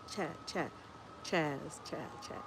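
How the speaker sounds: "Chaz" repeated very softly to test the short A; trying out pronunciation. Real, not acted. Streamer unsure of viewer's name, quietly testing "Chaz". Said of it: "How is it pronounced?".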